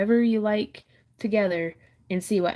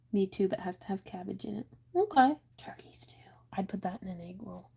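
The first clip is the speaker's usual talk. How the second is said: soft unvoiced aside; whispering; 8 dB quieter than usual. Real, not acted. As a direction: keep it to a whisper. Whispered asides reading comments.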